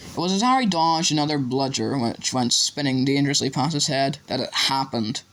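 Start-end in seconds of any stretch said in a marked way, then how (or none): none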